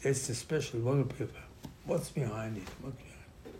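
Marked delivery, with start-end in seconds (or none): 2.8-3.5 breathy hushed whisper of the question. Shown as a demonstration. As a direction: lean in and whisper it like a secret. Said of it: "repeats question, then drops to a whisper".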